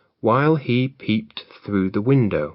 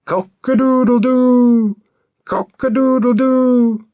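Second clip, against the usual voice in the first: very high, loud crow; rooster crowing; 13 semitones higher, 7 dB louder than usual. Acted. The cock crows to frighten the robbers, very high loud crowing. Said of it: "and the cock crowed".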